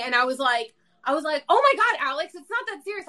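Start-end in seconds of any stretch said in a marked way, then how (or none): 1.5-3.1 high, loud mock scolding of oneself; retold yelling. Higher self to a whiny Alex, retold yell. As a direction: imitate yelling at yourself, fed up. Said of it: "I, like, yelled at myself".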